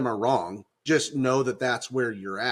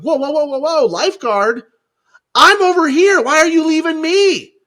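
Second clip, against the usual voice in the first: much higher, much louder mock-panicked yelling; hammed-up cry from the shore; panicked calling out; 11 dB louder than usual. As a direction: shout after the lifeguard who's leaving you. Calling out to departing lifeguard.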